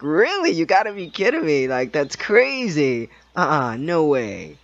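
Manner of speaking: loud, high playful disbelief to ease her tension; mock disbelief. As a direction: ham up the shock to lighten her worry. Laughing off the bad news.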